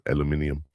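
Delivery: "Aluminium" said al-yoo-MIN-ee-um; British pronunciation. Shown as a demonstration. Showing how England says it, British pronunciation of "Aluminium". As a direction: sound the extra i in "Aluminium". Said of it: "That's how they say it in England".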